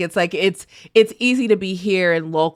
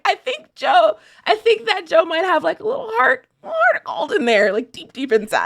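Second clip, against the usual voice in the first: laughing; 13 semitones higher than usual. Real, not acted. Laughing hopeful aside about Joe.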